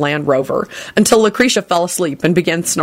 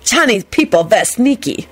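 pitched-up haughty foreign character; 4 semitones higher than usual. A narrator's reading. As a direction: pronounce it with a superior sniff. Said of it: "the man sniffed haughtily".

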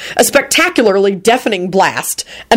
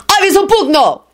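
high, exultant spell cry; triumphant yell; 13 semitones higher than usual. A narrator's reading. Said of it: "Radu yelled out triumphantly".

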